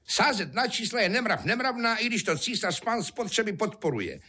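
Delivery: high-pitched nagging wife, mild shout; hubující žena. A narrator's reading. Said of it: "křičela a mávala rukama". (vysokým hlasem hubující manželka)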